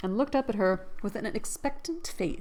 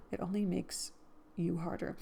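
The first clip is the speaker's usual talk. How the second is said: softer, subdued reading; quiet; 8 dB quieter than usual. Acted. Second take, quieter.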